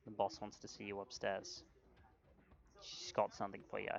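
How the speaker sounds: low, hushed word from the barkeep; softly passing on a message; quiet bartender. Acted. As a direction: lean in and keep it down. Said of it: "says quietly to you".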